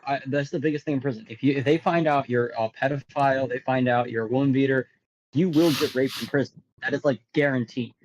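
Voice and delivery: ordinary talk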